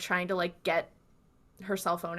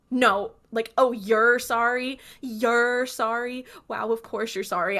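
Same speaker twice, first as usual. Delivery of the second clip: slow, louder mock-outraged sarcasm; upset, sarcastic; 2 semitones higher, 5 dB louder, slower than usual. Host voicing girlfriend rejecting her boyfriend's apology, bitterly sarcastic. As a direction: throw the apology back, dragging it out. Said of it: "having this, like, very upset moment".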